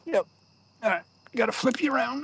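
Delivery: usual speaking voice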